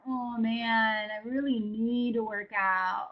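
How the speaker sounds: mock reluctant, slow "have to"; reluctant sigh. Reluctant self-talk about a workout, burdened sigh. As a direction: sound burdened by the chore.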